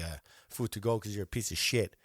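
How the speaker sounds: ordinary delivery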